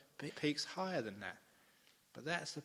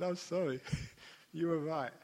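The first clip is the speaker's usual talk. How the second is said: laughing apology; 5 semitones higher, 5 dB louder than usual. Retold or imitated. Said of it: "I said".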